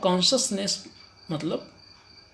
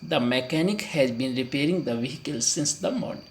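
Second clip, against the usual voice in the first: slow model sentence; much slower than usual. Shown as a demonstration. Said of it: "present perfect continuous".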